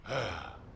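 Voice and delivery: soft exasperated sigh; weary sigh. Acted. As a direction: let out a quiet, weary sigh. Dismissing immature kids who misunderstand.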